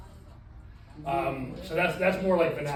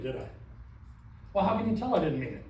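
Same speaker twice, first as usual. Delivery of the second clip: normal talking voice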